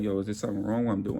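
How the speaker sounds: fast, soft anxious question; nervous coworker asking fast; rushed worried question. Retold or imitated. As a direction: blurt it quickly, unsure.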